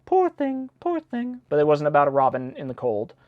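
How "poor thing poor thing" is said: high mock sung poor thing; lightly sung high imitation; quoting Sweeney Todd. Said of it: "where they go".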